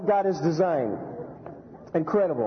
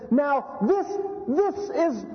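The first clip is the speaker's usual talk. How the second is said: higher, awestruck and halting; hammed-up wonder, slowing at the end; excited Adam; 7 semitones higher than usual. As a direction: voice the first man overwhelmed by his bride. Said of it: "He says".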